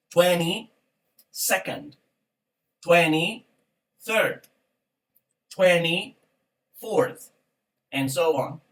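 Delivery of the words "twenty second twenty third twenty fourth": very slow "twenty... second, twenty... third, twenty... fourth"; split into parts. Shown as a demonstration. Teacher modelling ordinals for students, each "twenty" held apart from "second", "third", "fourth". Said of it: "la unidad es la que va a ser ordinal".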